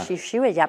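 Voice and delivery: "Xiu, xiu, allá" with both sh syllables and voiced zh; corrected attempt. Real, not acted. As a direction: repeat "Xiu, xiu, allá" carefully as sh, sh, zha. Student retries after correction. ("Xiu, xiu, allá" imitando la pronunciación catalana)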